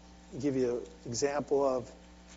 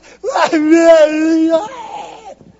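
high, loud hammed-up shriek of glee; mock shrieking laugh; 12 semitones higher, 18 dB louder than usual. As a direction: shriek like you just got a raise, overdone. Mock boss raise reaction.

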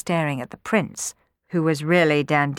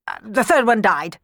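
higher-pitched uneasy blurt; 5 semitones higher, 4 dB louder than usual. A narrator's reading. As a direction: blurt out a hasty lie. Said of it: "he blurted out".

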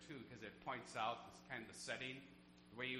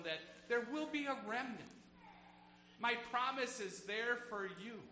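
full-force preaching; 9 semitones higher, 6 dB louder than usual. Acted. Pastor preaching against fear to his flock, fervent and loud. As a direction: drive God's promise home to the pews.

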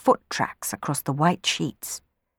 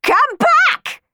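shrill, much higher screech; 8 dB louder than usual. Acted. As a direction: screech after them in a panic. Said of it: "screeched Miss Baggett".

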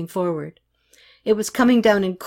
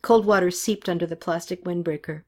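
plain reading voice

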